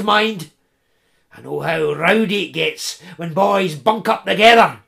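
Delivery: high, loud, grudging crone; relenting old woman. Acted. Old woman relenting to the boys, high and loud. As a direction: give in with a grumble.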